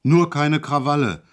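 sudden loud bellow. A narrator's reading. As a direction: bark the order at him. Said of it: "brüllte er auf einmal los".

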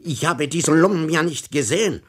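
loud, high sarcastic rant; a fed-up old gentleman lecturing the policeman; exasperated outburst. A narrator's reading. Tomsa loses patience with inspector. (gereizter, lauter Ausbruch)

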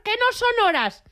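voz aguda de señora indignada desde el balcón; grito de señora. Retold or imitated. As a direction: grita como una señora harta del ruido. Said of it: "en posición de señora y grité". (señora en bata gritando a los del botellón)

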